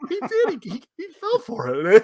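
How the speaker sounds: very high, teasing voice breaking into laughter; high-pitched needling, laughing at the end; gleeful mock taunt. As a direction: crow over a prank in a silly high pitch. Re-enacts gleeful taunt of friend.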